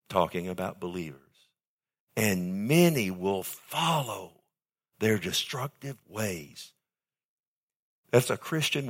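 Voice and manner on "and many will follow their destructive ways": and many will follow their destructive ways spoken slowly, stress on many; slow down and weigh many and their; deliberate warning read slowly; slow emphatic reading. Shown as a demonstration. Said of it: "Now listen carefully".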